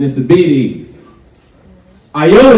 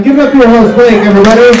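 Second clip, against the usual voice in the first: louder, higher crowd hype; hype intro; 7 semitones higher, 5 dB louder, faster than usual. Real, not acted. Comedian hyping the crowd for the host, loud.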